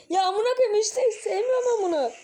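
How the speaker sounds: high, loud frightened cry after a jumpscare; scared outburst. Real, not acted. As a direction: yelp it out, really spooked. Jumpscare hits while playing.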